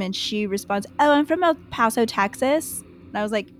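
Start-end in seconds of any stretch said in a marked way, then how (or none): 1.0-2.8 imitated British accent, slightly higher; fake English accent. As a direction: put on a dubious English accent. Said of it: "the weird fake accent".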